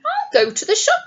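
decide brightly in the wolf voice; decided wolf. A narrator's reading. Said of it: "he decided".

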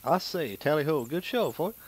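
loud, high, hammed-up British toff; British accent. Gamer mimics the guide to British accents, hammed-up British. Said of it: "A guide to British accents".

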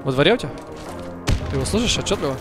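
normal talking voice

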